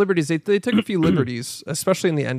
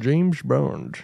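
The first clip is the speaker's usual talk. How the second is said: Sean Connery; 2 semitones lower than usual. Acted. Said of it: "more impressive than Sean Connery".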